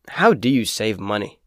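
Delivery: usual speaking voice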